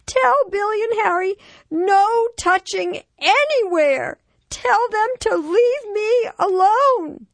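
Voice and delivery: put-on very high, loud, slow child's demand; upset little boy. A narrator's reading. Five-year-old to mom before cousins arrive, distressed demand. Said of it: "tears beginning to well in his eyes".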